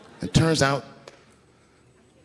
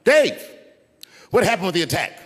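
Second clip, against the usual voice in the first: loud, high call from the crowd; retold heckler's yell; 7 semitones higher, 3 dB louder than usual. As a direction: holler the name out, then ask. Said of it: "someone in the audience screamed out".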